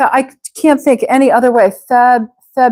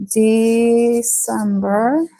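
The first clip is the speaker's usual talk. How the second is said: slow model word; much slower than usual. Shown as a demonstration. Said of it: "teacher models the word slowly".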